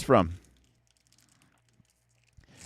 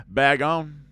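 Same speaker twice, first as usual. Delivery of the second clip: punchy put-on accent on "Bag on"; silly accent; 5 semitones higher, 5 dB louder than usual. Card opener announcing the pack in a mock accent. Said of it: "I can never just say that normally ... with that accent".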